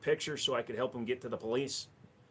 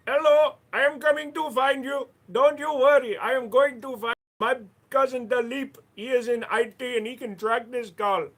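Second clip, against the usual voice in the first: loud, much higher hammed-up Indian accent; mock Indian accent; 9 semitones higher, 8 dB louder than usual. An angry Taken caller threatening the kidnapper, in mock Indian accent. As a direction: play a vengeful caller in a comic Indian accent. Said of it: "change up the accent all the time".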